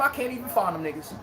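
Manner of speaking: usual speaking voice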